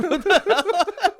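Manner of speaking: high, slow, squeaked through laughter; laughing doctor quote. Retold or imitated.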